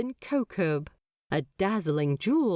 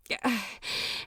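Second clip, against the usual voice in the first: soft breathy sigh of giving in; deflated sigh; 6 dB quieter than usual. A narrator's reading. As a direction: let the air out, resigned. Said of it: "The angel deflates then".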